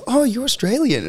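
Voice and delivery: high-pitched imitation of impressed foreigners; mock excited recognition. Foreigners recognising an Australian abroad, mock excited. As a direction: pitch it way up, playfully amazed. Acts out delighted foreigners overseas.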